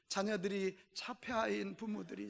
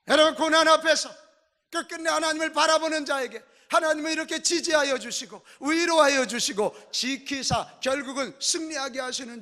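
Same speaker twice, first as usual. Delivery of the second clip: loud, raised-pitch sermon proclamation; full-force preaching; 6 semitones higher, 9 dB louder than usual. Real, not acted.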